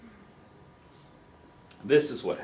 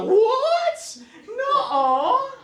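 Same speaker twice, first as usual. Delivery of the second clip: very high, squealed astonishment; mock disbelief; much slower than usual. Person told the baby is coming, mock disbelief. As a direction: imitate someone stunned on the phone, pitch shooting up.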